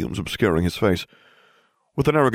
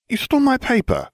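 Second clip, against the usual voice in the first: high-pitched pouting complaint; a mild, high pout; pouty. A narrator's reading. Friend accuses narrator over breakfast, pouting. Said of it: "Hermione pouted".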